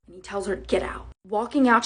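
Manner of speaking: ordinary talk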